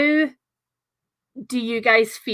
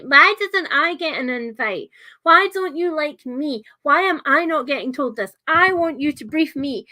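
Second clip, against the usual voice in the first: higher-pitched demanding whine of complaint; showy Regal; 6 semitones higher, 3 dB louder than usual. Retold or imitated. Mimicking a character bursting in to demand answers, showy and petulant. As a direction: burst in with big, showy indignation. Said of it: "big showy things".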